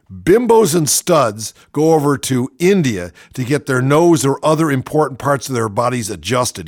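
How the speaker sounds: ordinary delivery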